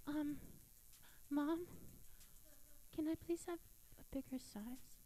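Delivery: put-on small, soft, hesitant kid asking; shy girl whispering to mom; timid whisper. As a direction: barely ask it, embarrassed. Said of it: "Cuban women have no voice modulation".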